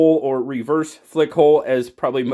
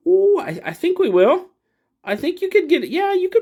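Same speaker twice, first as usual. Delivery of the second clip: excited; 14 semitones higher than usual. Real, not acted. Excited about lanyard fit.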